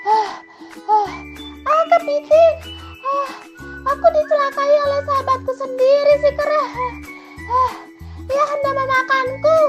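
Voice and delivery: very high, loud squeal between gasps; out-of-breath hen blurting out the betrayal; squeaky distressed gasping; panting chicken. Acted. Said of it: "dengan napasnya yang masih terenggak-enggak".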